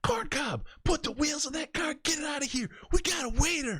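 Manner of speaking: imitated frantic boss, screeched very high; retold screamed order. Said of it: "comes out screaming".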